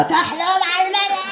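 lightly voiced Yemenite grandmother. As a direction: answer like a curt grandma. Grandmother voice again.

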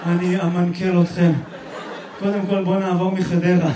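low, monotone brainwashed recital. Acted. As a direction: drone like someone freshly brainwashed. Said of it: "צריך לך שטיפת מוח עכשיו".

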